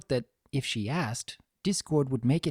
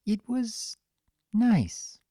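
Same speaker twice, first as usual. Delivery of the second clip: small, breathy, higher answer; timid whisper; 9 semitones higher, much slower than usual. Acted. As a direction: barely dare to speak.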